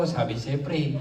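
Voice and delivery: a low, soft smug reply; low mock body voice. Comic voicing his body low and soft.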